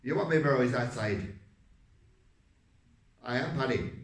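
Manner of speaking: loud Irish accent pushed from the back of the throat; Irish character voice, chest pushed out; Paddy. Shown as a demonstration. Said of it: "So when I was speaking, it was like".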